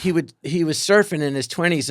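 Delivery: normal talking voice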